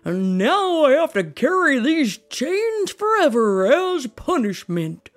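loud, booming spectral voice; ghost voice. Acted. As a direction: moan like a guilty ghost. Voicing Marley's ghost.

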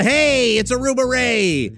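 high, loud smarmy barman voice; smarmy Aruba Ray. Acted. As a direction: greet them like a shady bar owner. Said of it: "dip into this character named Aruba Ray".

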